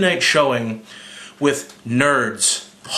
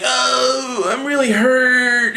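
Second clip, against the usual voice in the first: mock pained whine; 10 semitones higher, much slower than usual. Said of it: "Tony's like".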